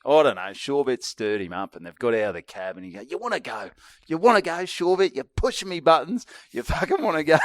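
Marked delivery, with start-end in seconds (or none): 3.0-7.4 high-pitched, worked-up challenge; retold tipsy "come at me" rant; drunk mate picking a fight. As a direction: square up to him, mock-aggressive and high. Drunk mate challenging him in story.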